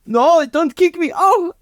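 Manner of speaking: high-pitched mock pleading, ending in a wail; pleading German man. German man being kicked off Michael's ankle, high hammed-up plea. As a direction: plead high, then yelp.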